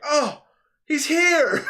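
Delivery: high, loud delighted cry; thrilled he finally showed up; excited. Retold or imitated. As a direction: greet him like a long-lost friend. Excited greeting retold.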